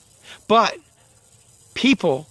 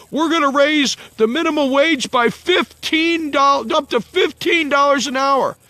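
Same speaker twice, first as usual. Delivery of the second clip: mock politician voice; 5 semitones higher than usual. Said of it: "they're gonna say".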